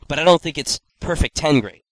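ordinary delivery

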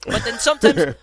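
loud, high mock Latin American accent. Telling how the trainer talked, loud Latin American accent. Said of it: "he talked like this".

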